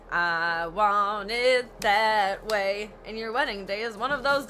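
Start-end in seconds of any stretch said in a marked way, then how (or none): 0.1-2.9 slow, drawn-out spoiled bride; bratty demand. Retold or imitated. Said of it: "be a bitch ass and say".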